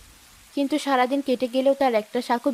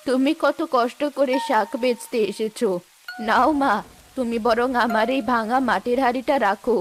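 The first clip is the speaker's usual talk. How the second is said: put-on grateful old woman.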